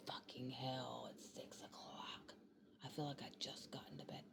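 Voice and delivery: groggy whisper. Retold or imitated. Imagined groggy morning thought.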